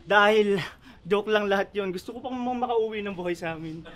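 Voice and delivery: soft, low retreat after bravado; meek backing down. Acted. Rapper wants to get home alive, meek backing down. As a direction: drop the threat, quietly and low.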